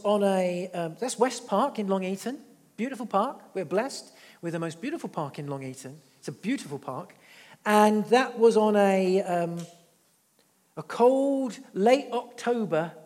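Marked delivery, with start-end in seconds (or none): none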